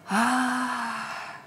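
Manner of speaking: тихий печальный «Ах»; вздохни тихо и грустно; грустный вздох. A narrator's reading. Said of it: "стало грустно". (грустный вздох ёжика)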